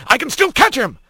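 high, urgent call; shouting. A narrator's reading. Sergeant eager to chase Otho, calling out. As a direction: call it out in haste. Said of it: "he heard Hawk shout".

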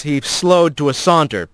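ordinary delivery

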